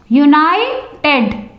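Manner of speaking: united broken as uni-ted; split into syllables. Shown as a demonstration. Teacher demonstrating syllable breaks, united split as uni-ted. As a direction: pause inside united before the final ted. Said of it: "these words have two syllables".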